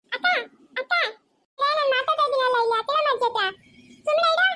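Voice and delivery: put-on high-pitched child pestering with questions; complaining little child. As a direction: demand answers in a high kid voice. (ದೂರು ಹೇಳುವ ಪುಟ್ಟ ಮಗುವಿನ ಧ್ವನಿ)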